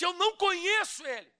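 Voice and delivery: high, loud denial. Acted. Peter denying Jesus before the crowd, high and loud.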